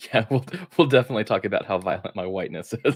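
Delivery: quick, laughing reply. Real, not acted. Co-host playing along with a tease about whiteness, laughing. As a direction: say it through real laughter, rushing along.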